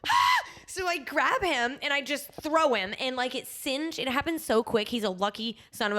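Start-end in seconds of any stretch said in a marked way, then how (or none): 0.1-0.5 high-pitched gasping scream, louder; startled shriek. Retold or imitated. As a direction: let out a sudden shriek of alarm. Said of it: "And I'm like".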